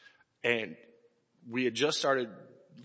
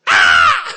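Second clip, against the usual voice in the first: sudden high loud yelp; high shout; 16 dB louder than usual. Retold or imitated. Dad voicing the slap with a loud high yelp.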